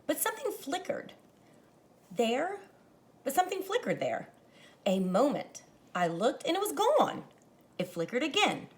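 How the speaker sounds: expressive read-aloud to a class; story narration. A narrator's reading. Teacher reading a picture book to her class, expressive narration. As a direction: paint the evening for young listeners.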